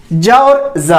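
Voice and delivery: first "जा" jaa, second zaa; jaa, then zaa; J versus Z contrast. Shown as a demonstration. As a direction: contrast hard j with buzzing z in "जा". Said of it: "सुन पा रहे हैं अंतर".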